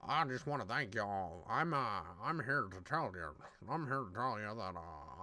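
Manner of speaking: low, soft, rambling parody of a rapper; weird voice imitation. Podcaster mocking the rapper's rambling, weird low voice. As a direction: mumble it low, mimicking his odd voice. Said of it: "his voice is just so weird, bro".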